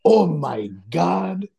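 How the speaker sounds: put-on drawn-out, very slow African-accented oh my god; imitating an African coworker's groan. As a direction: stretch it like the coworker does. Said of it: "he's always like".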